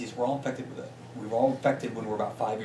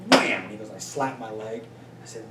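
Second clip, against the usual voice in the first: ordinary talk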